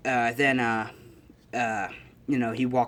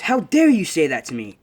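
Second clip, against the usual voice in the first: loud, higher, affronted protest; outraged, raised voice; indignant retort; 4 semitones higher, 8 dB louder than usual. Acted. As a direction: act deeply offended. Retold character takes offence.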